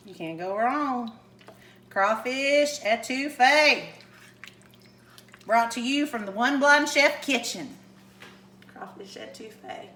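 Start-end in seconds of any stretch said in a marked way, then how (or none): 1.9-3.8 slow, savored presentation; drawn-out dish announcement. Real, not acted. Cook presents crawfish etouffee to viewers, drawn-out announcement. 8.7-9.9 very soft, low near-whisper; hushed repeat. Real, not acted. Cook savors the dish name, hushed.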